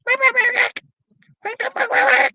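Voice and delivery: frantic high squawks run together; rapid-fire cockatiel screaming. Retold or imitated. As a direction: screech it fast like a frenzied bird. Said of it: "He just say it so fast".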